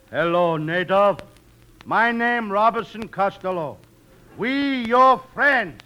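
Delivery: slow, stilted greeting; pidgin to a native. Acted. Castaway greets an island native, slow broken-English delivery. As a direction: space out each word simply. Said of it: "Speak to him, Costello".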